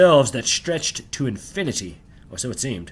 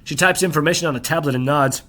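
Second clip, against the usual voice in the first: usual speaking voice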